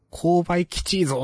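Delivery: a light take on a dialect-heavy guy's catchphrase; Okayama dialect. Retold or imitated. Retelling an Okayama fighter's taunt to a friend, lightly imitated Okayama dialect. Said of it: "岡山かなんかの方言バリバリの人".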